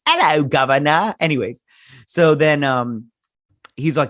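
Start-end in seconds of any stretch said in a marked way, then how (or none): 0.0-1.2 loud, higher-pitched Cockney greeting; Cockney accent. Acted. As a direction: ham up a Cockney call. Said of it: "it's Cockney accent".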